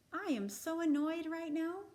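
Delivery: name the feeling out loud, mildly annoyed; calm but clearly annoyed example line; annoyed statement. Shown as a demonstration. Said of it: "Practice saying".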